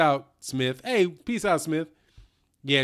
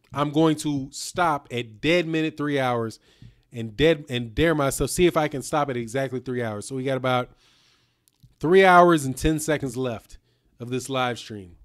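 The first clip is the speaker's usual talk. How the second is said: normal talking voice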